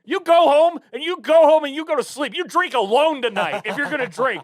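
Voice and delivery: high, loud scolding; loud rant. Real, not acted. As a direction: yell at the crowd to go home. Ranting at holiday drinkers.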